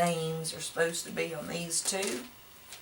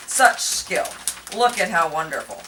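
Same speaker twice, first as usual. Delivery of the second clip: sarcastic praise; 8 dB louder than usual. Pretend. Mocking own clumsy wrapping.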